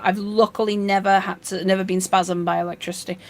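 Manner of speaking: ordinary talk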